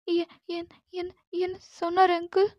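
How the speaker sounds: high, soft, trembling plea to let her go; frightened girl pleading. Acted. Captive girl begs Jimman to send her home, frightened pleading. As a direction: beg your captor like a scared young girl. Scared girl stammers question.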